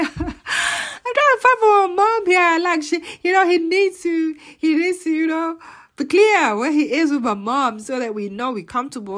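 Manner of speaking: high, loud outburst, laughing at the start; worked-up, high-pitched objection; indignant protest. Retold or imitated. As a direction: plead your case loudly in disbelief. Acting out protest to God.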